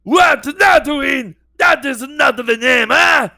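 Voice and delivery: put-on high shouting Watto accent. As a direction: squawk it like the junk dealer Watto. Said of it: "sounding like the stereotype that was in".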